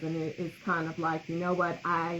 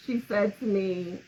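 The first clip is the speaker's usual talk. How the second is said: usual speaking voice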